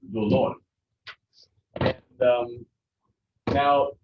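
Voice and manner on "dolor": "Dolor" with the second syllable louder, do-LOR; stress on LOR. Shown as a demonstration. Teacher showing class the accent, "Dolor" stressed on LOR. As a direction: hit the final syllable of "Dolor". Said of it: "It's gonna be lor".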